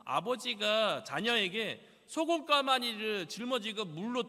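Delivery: loud, slow scolding of a son; angry father's lecture. Retold or imitated. Father berating son for disobeying, loud and slow. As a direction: lay down the law like a stern father.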